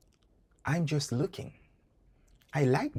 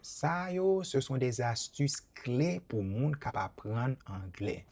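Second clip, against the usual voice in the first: ordinary talk, nothing marked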